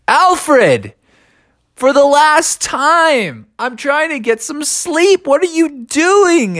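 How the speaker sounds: high, pleading exasperation; worn-out, high-pitched complaint; exasperated scolding. A narrator's reading. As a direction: scold a noisy neighbor who woke you. Bear scolds owl for noise.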